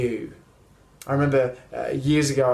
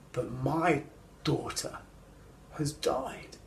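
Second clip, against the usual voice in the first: broken whisper; 11 dB quieter than usual. Retold or imitated. Jairus' grief voiced.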